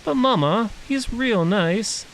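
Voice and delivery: put-on high, slow, pleading little boy; boy protesting as he's pulled away; pleading child. A narrator's reading. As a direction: beg mama to believe him. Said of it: "he cried as they went".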